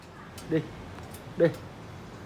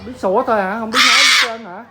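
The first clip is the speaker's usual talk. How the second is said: louder, higher scolding; scolding the bird; 6 semitones higher, 9 dB louder than usual. Real, not acted. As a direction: tell off the pet, loud and high.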